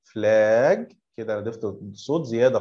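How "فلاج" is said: فلاج sung out with the A stretched long, slow; sing-song drawl. Shown as a demonstration. Teacher showing kids the wrong way, فلاج drawn out. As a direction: drag the A of فلاج like a tune. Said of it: "لو هنغم كده فلاج".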